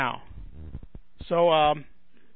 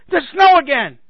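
loud, high cry of disbelief; mock exasperation; 7 semitones higher, 9 dB louder than usual. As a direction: wail it in comic dismay. Acts out dismay at snow.

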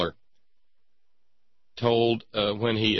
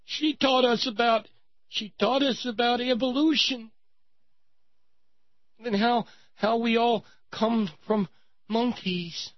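high-pitched mock little-girl voice; crying little girl; 13 semitones higher than usual. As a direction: play a wronged child tattling to her parents, very high. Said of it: "And she said".

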